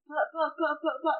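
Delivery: mock stammer. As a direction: sputter like a caught-out guy. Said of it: "how he's like".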